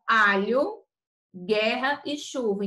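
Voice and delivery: ordinary talk, nothing marked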